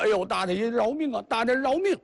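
high pleading cry for mercy. Acted. 两人跪下求饶. (尖着嗓子哀求饶命)

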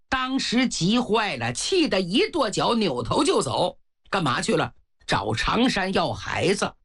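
usual speaking voice

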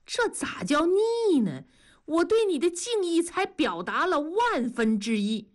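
high, exaggerated mock protest; chuckling flattery. A narrator's reading. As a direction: lay the devotion on thick and playfully. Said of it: "大庄嘿嘿地笑着说".

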